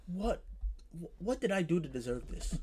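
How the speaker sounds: soft, low, helpless question; stunned lament. Retold or imitated. Student hearing all rooms are booked, quietly helpless. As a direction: murmur it in stunned self-pity. Retold stunned reaction to bad news.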